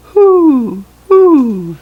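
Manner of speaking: high, loud hooting owl call. Pretend. Reading to a child, hooting like an owl. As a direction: hoot like an owl. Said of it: "it makes the sound".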